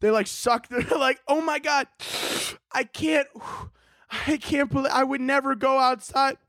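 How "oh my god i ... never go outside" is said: high, whiny "oh my God" voice; mock panicky homebody. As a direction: ham up the scared indoor type, pitched up. Mocking scared people indoors.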